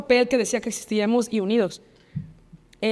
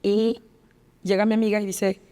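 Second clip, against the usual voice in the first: ordinary delivery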